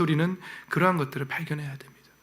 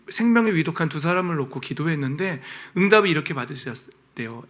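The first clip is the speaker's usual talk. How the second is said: normal talking voice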